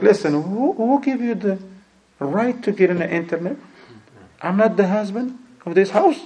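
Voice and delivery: a man whose pride is hurt, snapping back at his wife; indignant husband. Retold or imitated. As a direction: bristle like a proud husband put in his place. Arrogant husband scolds his wife.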